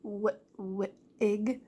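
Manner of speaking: "wi-, wi-, ig" in separate chunks; sounding out. Shown as a demonstration. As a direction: break wig up, repeating the start, "wi-, wi-, ig". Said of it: "In the word wig".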